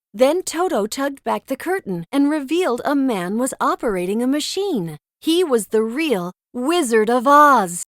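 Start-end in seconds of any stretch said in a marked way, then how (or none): none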